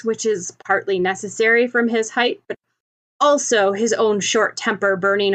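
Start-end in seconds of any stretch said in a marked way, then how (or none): none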